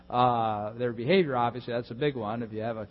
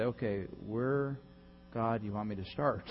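humble prayer; 3 semitones lower, 5 dB quieter than usual. Acted.

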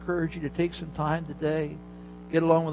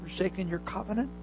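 softer and a bit higher, confidence gone; less confident; 4 semitones higher, 6 dB quieter than usual. Acted. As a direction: Elijah answers God a second time, unsure and subdued. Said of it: "Not as much confidence this time".

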